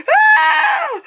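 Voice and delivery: high screaming ah; excited shriek. Retold or imitated. Fans screaming in the theater, high shriek. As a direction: shriek like a fan. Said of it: "they were like".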